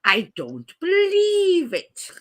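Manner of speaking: high, long-drawn BELIEVE; milk the exasperated catchphrase slowly; Victor Meldrew. Acted. Doing best impression on request, Victor Meldrew catchphrase. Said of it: "do your best impression".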